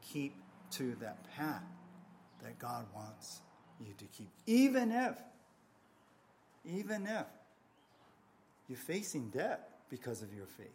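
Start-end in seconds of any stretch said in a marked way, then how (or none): 4.5-7.3 emphatic outburst. Real, not acted. Preacher's emphatic build.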